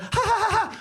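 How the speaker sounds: high staccato "ha ha" laugh; gloating fake laugh, high-pitched; hammed-up mocking cackle. Gloating at crashed rider.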